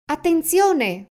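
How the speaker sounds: sharp high shouted warning; a sudden cry of alarm. Acted. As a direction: yell it to warn the fox's friend. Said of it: "urlò".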